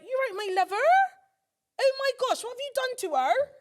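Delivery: very high, loud fussing Bristol lady; high Bristol woman. Retold or imitated. Bristol woman in Nando's fussing over the crying girl, very high and loud. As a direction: squeal it like a frantic stranger comforting her. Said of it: "And she's like".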